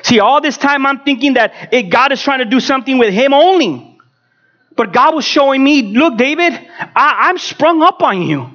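high-pitched, insistent pulpit delivery; impassioned preaching. Real, not acted. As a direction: build promise upon promise with preacher's fervor.

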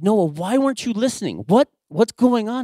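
loud, high-pitched scolding; frustrated dad voice. Retold or imitated. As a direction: demand an explanation from your kid, exasperated. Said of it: "That made me so mad".

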